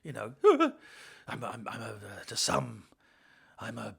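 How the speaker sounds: low, hesitant Batman musing; low and quiet, like a wordy Batman; rambling Batman voice. Retold or imitated. Parodying a long-winded Batman.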